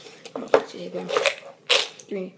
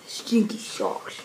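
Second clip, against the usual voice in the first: disgusted; much slower than usual. Real, not acted. Just got the bad bean.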